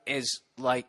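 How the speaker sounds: ordinary talk, nothing marked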